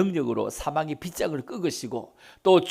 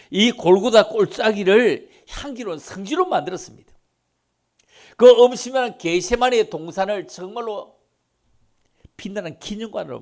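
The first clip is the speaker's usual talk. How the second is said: loud, higher proclaiming; preaching at full force; 5 semitones higher, 8 dB louder than usual. Real, not acted. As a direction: thunder it out from the pulpit. Preacher building to climax. (설교하듯 힘차게 외치며)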